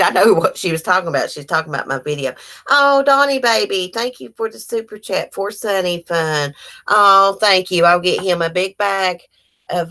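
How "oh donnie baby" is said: ordinary talk, nothing marked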